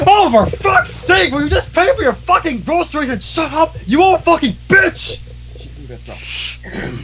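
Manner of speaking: high, furious rant rising to a shout; furious tirade. Acted. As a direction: let it all explode at her. Cheerilee explodes at old mare.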